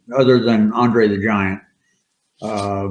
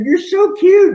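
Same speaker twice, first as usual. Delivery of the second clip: much higher and louder, a hammed-up swoon; mock swooning woman; 5 dB louder than usual. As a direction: squeal it like a smitten fan. Woman pinching the wrestler's cheek.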